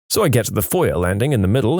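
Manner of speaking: ordinary delivery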